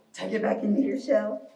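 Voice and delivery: low, pretend-creature voice; low turtle voice. Teacher guiding little turtles into shells, playfully low voice. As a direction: drop the pitch for the instruction.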